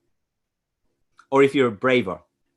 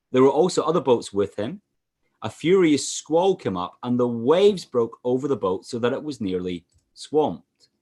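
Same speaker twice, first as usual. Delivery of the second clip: ordinary delivery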